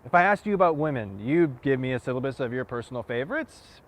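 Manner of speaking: impassioned challenge, punching key phrases; forceful monologue. Acted. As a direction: push each point home with rising pitch and force. Same monologue, second take pushed harder.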